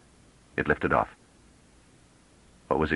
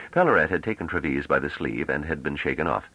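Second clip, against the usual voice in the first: usual speaking voice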